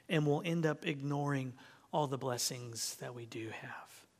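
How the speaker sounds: whispered, soft warning; hushed. Real, not acted. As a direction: lean in and whisper it.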